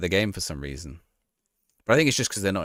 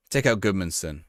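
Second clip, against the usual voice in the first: ordinary talk, nothing marked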